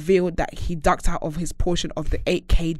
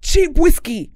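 high, loud disbelief; indignant loud rant; 8 semitones higher, 4 dB louder than usual. Real, not acted. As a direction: rant about the cheap whiskey.

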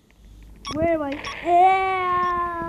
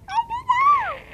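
much higher, louder indignant squeak; squeaky denial; 6 dB louder than usual. Pretend. Gamer protesting in a high cartoon voice.

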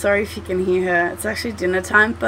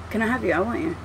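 ordinary talk, nothing marked

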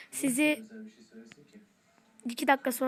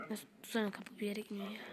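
hushed; 3 semitones lower, 11 dB quieter than usual. Real, not acted. Hiding, muttering softly.

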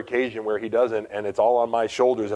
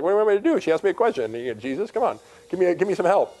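high-pitched mock plea for help; beg, half-exasperated, pitched up; exasperated pleading; 8 semitones higher, faster than usual. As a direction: replay a flustered appeal to Jesus. Acts out pleading with Jesus for help.

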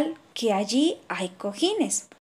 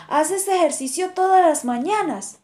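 higher, louder teasing question; mocking; 6 semitones higher, 4 dB louder than usual. A narrator's reading. Blue jay mocks the kangaroo, teasing tone. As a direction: tease him with a smirk. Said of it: "en tono burlón".

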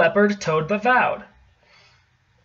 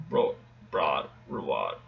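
1 unsure mumble; 12 dB quieter than usual. Real, not acted. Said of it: "I don't know".

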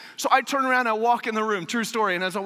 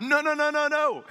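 higher rising no's in alarm; panicked denial; 8 semitones higher than usual. Retold or imitated. Driver seeing tire pressure fall, high alarmed no's. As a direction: plead with the dashboard in dread.

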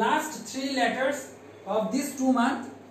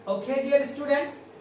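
usual speaking voice

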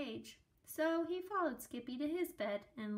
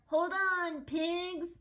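higher, louder shout to the pigs; loud call; 8 semitones higher, 9 dB louder, much slower than usual. A narrator's reading. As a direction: call out to hold on tight.

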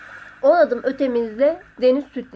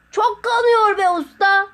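high, loud, drawn-out complaint; pleading call; 8 semitones higher, 5 dB louder than usual. A narrator's reading. Hurt Sotiri pleads with his master, drawn-out pleading call. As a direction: stretch out a pained call for help.

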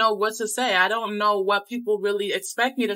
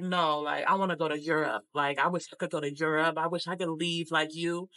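lower, a touch wistful imitation of him; imitating envious man; 4 semitones lower, 3 dB quieter than usual. Quoting the envious man from Hout Bay, lightly voiced and lower. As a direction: mimic his longing to leave, lightly voiced. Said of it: "but he was angry".

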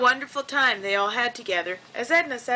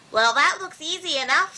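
slightly perky, higher character voice; a touch of breezy confidence, pitched up; lightly voiced Luella; 5 semitones higher than usual. A narrator's reading. Narrator voices Luella eyeing the walnut.

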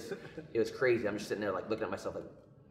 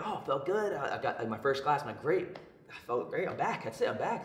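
bright, much higher, bubbling with relief; excited; 5 semitones higher than usual. Retold or imitated. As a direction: sound thrilled to be back. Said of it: "And I'm like".

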